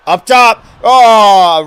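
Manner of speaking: loud, high play-by-play call; wrestling commentator. Acted. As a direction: call the pin count like a ringside announcer. Live play-by-play of the match.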